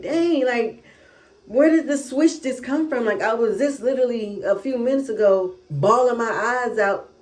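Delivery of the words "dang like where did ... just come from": high, puzzled disbelief; surprised. Real, not acted. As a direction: wonder aloud, baffled. Amazed at her mood switch.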